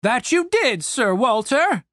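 put-on young soldier voice.

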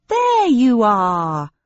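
loud, slow and commanding. Acted. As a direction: call out boldly across the crowd. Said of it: "I said in a loud voice".

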